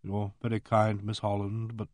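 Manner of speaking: subdued, level monotone; toneless. A narrator's reading. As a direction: speak as if drained of all expression. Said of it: "said in a toneless voice".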